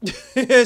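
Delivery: sudden loud high burst of laughter. Real, not acted. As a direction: break into a real laugh. Laughing at Rita's line.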